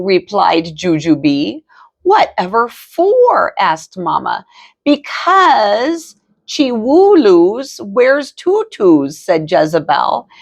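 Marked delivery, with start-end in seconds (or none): 2.0-3.5 high surprised question; puzzled mama. A narrator's reading. Mama asks the girls why, high and surprised. 4.8-9.2 slow, high sing-song sister; child voice. A narrator's reading. Jezebel tells Mama about tutus, slow and high.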